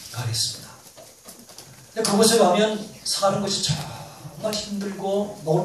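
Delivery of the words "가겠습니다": hushed humble answer. Retold or imitated. Missionary answers the pastor.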